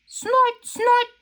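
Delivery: high squealy snorts; pig snorts. Acted. George snorting that he does not know. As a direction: snort back like a piglet.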